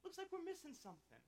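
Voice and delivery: put-on high, light boy's voice asking; young Isaac. Isaac asking his father about the missing lamb, high and boyish. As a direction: sound like a puzzled child looking up at Dad. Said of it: "he looks at you and says".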